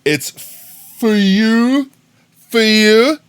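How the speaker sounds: high, loud squeaky fuchsia; high squealed word; mock shriek. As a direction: parody the robot shrilly. Mocking over-the-top pronunciation.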